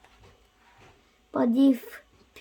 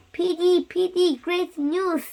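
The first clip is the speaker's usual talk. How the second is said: higher, bright calling out with joy; excited calling out; 5 semitones higher than usual. Acted. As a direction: call out to your friend with good news. Gerald calls happily to Piggie.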